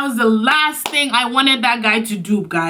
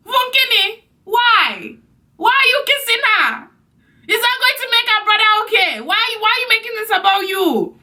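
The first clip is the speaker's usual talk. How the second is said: high, exasperated scolding; indignant scold; 12 semitones higher than usual. Retold or imitated. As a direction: tell him off in disbelief. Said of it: "scolding him in retort".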